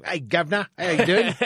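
loud, high hammed-up Cockney; broad mock-Cockney accent. Shown as a demonstration. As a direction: play up a cartoon Cockney greeting. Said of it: "give me some Cockney".

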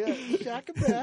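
high, breezy bro voice; surfer bro. Pretend. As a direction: chill surfer greeting. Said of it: "I was like".

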